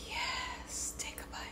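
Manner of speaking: very soft, unvoiced-sounding whisper; hushed, indulgent urging. Real, not acted. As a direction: whisper it like a tempting secret. Hushed urging before tasting.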